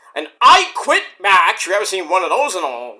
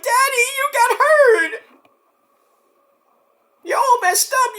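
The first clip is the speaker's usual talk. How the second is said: put-on much higher, slow, tearful kid voice; crying child. Kid crying at bloody daddy backstage, high and tearful. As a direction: whimper it out like a hurt toddler. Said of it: "kids are crying".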